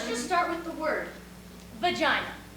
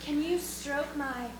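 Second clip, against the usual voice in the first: mock seductive; 2 semitones lower, slower than usual. Acted bedroom line during sex.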